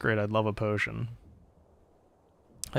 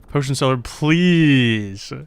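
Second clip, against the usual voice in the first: loud, slow, stretched begging; hammed-up wailing plea; drawn-out mock plea; 3 semitones higher, 10 dB louder, much slower than usual. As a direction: drag out "please" like a desperate cry.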